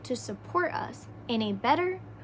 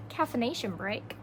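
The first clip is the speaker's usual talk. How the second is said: usual speaking voice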